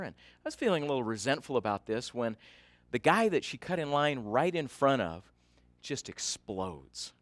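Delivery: usual speaking voice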